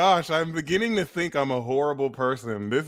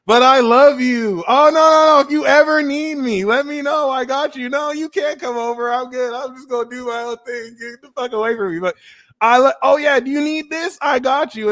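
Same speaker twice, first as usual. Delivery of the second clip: much higher, loud sing-song mock voice; high cartoon voice flipping between love and go away; mock clingy-dismissive; 12 semitones higher, 6 dB louder than usual. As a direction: play both pushy and loving, hammed-up. Acting out mixed signals to friends.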